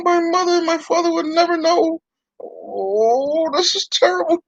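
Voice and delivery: a sorry dummy mourning its nose; puppet voice. Acted. Noseless puppet laments.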